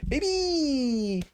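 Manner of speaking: high stretched mock brag, drawn out on Baby; hammed-up high gloat. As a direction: camp it up, stretching the last word. Repeating the gloat, higher.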